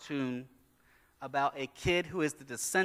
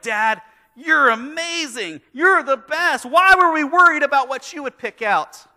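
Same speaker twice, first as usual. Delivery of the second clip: much higher and louder, gushing; thrilled kids; 14 semitones higher, 16 dB louder than usual. Retold or imitated. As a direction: kids cheering dad after the film. Kids thrilled after movie.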